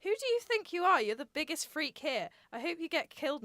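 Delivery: loud, high, outraged shouting; angry yell. Acted. Foxy screaming at Tony mid-argument, outraged. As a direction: scream it at him in a rage.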